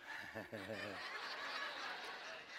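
soft, low chuckle. Real, not acted. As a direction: chuckle quietly at your own joke. Laughs at his own joke.

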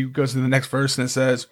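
normal talking voice

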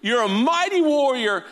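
high, emphatic divine proclamation; God calling Gideon. Acted. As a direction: proclaim it as God rallying a doubter. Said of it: "God comes on the scene and says".

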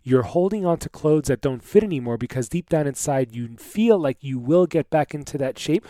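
ordinary delivery

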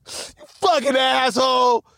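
real irritation bursting out; angry outburst. Real, not acted. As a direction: yell it at the teacher who mocked you. Venting at old gym teacher.

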